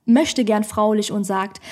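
ordinary talk, nothing marked

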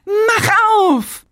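high, loud transformation cry; bright magical girl. Retold or imitated.

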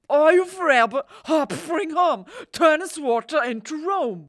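high, shouted slurred spell; mumbled Irish accent. Retold or imitated. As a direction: yell the spell half-swallowed, Irish. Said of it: "so undeutlich ausgesprochen". (laut, hoch und genuschelt mit irischem Akzent)

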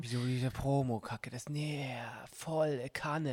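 slow whispered grumble. Real, not acted. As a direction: hiss it under your breath, slowly. Grumbling aside about the promo.

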